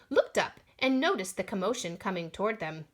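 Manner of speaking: ordinary delivery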